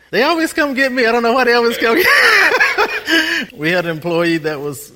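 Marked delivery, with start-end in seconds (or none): 0.1-2.1 higher and louder, fast and laughing; tickled by always being fetched; amused laughing exclaim. Real, not acted. 2.2-3.5 ringing high laugh; amused laugh at himself; loud high laugh. Real, not acted.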